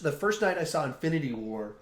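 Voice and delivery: ordinary talk, nothing marked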